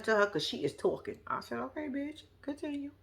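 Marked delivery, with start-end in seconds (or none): 1.6-2.9 much softer, under her breath; hushed aside. Retold or imitated. Letting Amber keep ranting, hushed under-breath aside. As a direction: murmur it so nobody hears.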